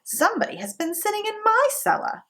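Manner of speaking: high, quick mother bear voice; mama bear's middle voice, high and fast. A narrator's reading. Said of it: "in her middle voice".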